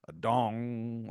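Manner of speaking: sustained imitated dong; grandfather clock chime. Game master showing the midnight chime, ringing imitation. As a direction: let it ring out like a clock striking. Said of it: "big grandfather clock that goes".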